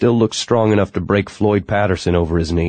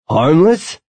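high rising incredulous shout; 10 semitones higher, 5 dB louder than usual. A narrator's reading. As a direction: throw the word back, high and loud. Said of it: "Mal rounded on him".

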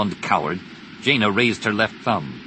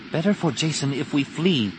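higher-pitched character voice; Tenel Ka's voice; 7 semitones higher than usual. Acted. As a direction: advise in a raised character pitch.

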